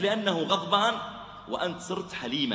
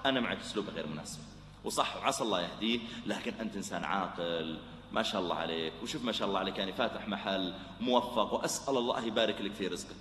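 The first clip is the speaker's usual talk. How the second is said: soft, much lower, quick flattery; soothing agreement; 8 semitones lower, 6 dB quieter than usual. Acted. Preacher flattering the calmed shopkeeper, soft and low. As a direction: agree and flatter him gently.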